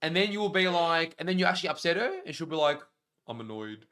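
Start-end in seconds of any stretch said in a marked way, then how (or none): 3.3-3.9 imitating polite annoyance, softly; quietly understate it, G-rated and innocent; innocent, plain annoyance. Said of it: "just innocently and plainly".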